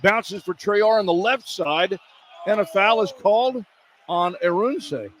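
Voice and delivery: running call of the drive and foul; live play-by-play. Real, not acted. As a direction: narrate the play as it happens.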